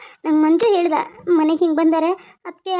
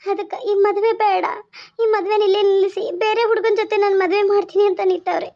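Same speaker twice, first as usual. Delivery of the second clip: halting account through tears; tearful. Acted. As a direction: tell it in sobbing, broken phrases. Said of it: "ಯಾಕೆ ಹತ್ಕೊಂತ ಬಂದಿದ್ಯಾ". (ಅಳುತ್ತಾ ತಡೆತಡೆದು ಹೇಳುವುದು)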